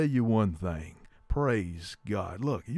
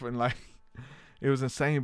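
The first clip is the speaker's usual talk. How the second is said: ordinary talk, nothing marked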